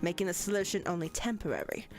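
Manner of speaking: lightly British professor reading; a hint of a stern British lecturer; British accent. Professor voice continues.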